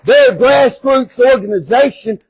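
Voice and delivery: rallying shout to the crowd. Real, not acted. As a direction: call it out loud and fired up.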